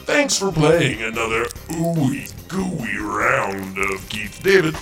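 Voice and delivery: normal talking voice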